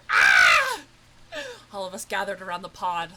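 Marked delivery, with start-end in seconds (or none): 0.1-0.8 high, loud yelp; sharp cry. Real, not acted. Gamer reacting to the pod, sharp cry. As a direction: shout out suddenly.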